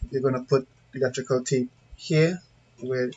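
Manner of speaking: ordinary talk, nothing marked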